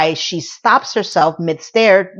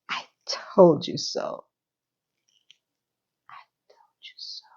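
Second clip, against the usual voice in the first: gloating whisper; 2 semitones lower, 10 dB quieter than usual. Real, not acted. Podcaster gloating to listeners over the episode, fading whisper. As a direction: whisper it, then let it almost vanish.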